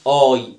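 "آي" said loudly as a long eye; long i sound. Shown as a demonstration. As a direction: hit "آي" firmly as the letter name I. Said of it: "بنطقها إيه؟".

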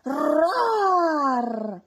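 high, loud drawn-out dinosaur roar; big booming storybook roar; vigorous roar. A narrator's reading. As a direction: roar with full force. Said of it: "Rosy ruggì con vigore".

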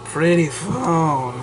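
slow put-on character voice; entranced toy figure. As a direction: draw out each word in mock wonder. Toy figure marvels at the phone.

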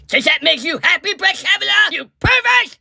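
jab it at him; mock outrage. Pelosi accusing Kavanaugh, a high angry cry.

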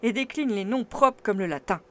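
loud, haughty explanation; lecturing uncle. A narrator's reading. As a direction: lecture him with a shrug.

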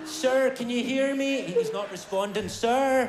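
slow, put-on American responder; American accent. Rescuer calling to an unresponsive man, put-on American accent. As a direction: call out slowly in an American accent. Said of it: "having an American accent there".

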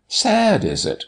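high-pitched cry falling away; cried out. A narrator's reading. Doctor answering Martha's worry, crying out. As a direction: exclaim it, starting very high. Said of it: "cried the doctor".

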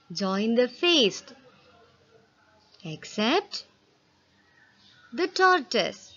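measured, expressive classroom read-aloud; reading a poem to children. Acted. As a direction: read it like a storybook verse for the class. Reads poem stanza to class.

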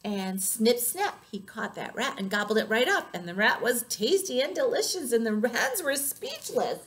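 plain reading voice

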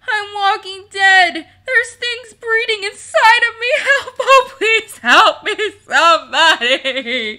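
high, very loud wailing plea; blubbering. A narrator's reading. Rollo begs the kobolds for help, loud blubbering wail. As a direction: wail hysterically for help, voice pushed way up. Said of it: "he blubbered".

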